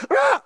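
short, high imitated scream; sudden hammed-up labor cry; mock travailing scream. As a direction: burst out in a fake birthing shriek. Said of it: "we're travailing right now".

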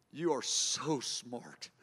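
soft, slow and low, like a private prayer; tender murmur. Shown as a demonstration. Preacher showing how he talks to God, softly. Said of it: "I love to say".

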